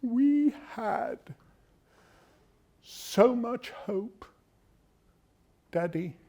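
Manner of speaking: soft, high, slow and wounded; a hushed plea to a father, drawn out with pauses; upset daughter. Acted. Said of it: "Daddy when she was upset".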